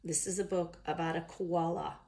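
closing line in an attempted Australian accent. Reader closing a book about a koala, attempted Australian accent. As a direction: finish the book in amateur Australian. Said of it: "read this in an Australian accent".

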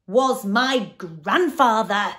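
very loud, slow monster wail; anguished cry. A narrator's reading. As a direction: wail it, dragging the words out. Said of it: "cried the anguished Din".

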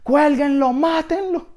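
very high, loud screech; acted frenzied high-pitched cry; mob yell. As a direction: scream for the execution as a crowd. Mob cries for his hanging.

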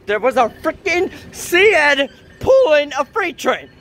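high, slow, incredulous outburst; freaking out. Real, not acted. Vlogger stunned by a train sighting, freaking-out disbelief. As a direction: stretch each word in high-pitched disbelief.

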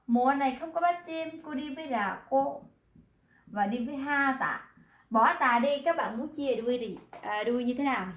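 normal talking voice

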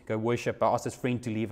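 usual speaking voice

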